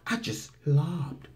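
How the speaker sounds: slow, soft mocking quote; soft lob. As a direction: stretch it out softly, like a gentle lob. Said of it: "lob is a soft versus throw".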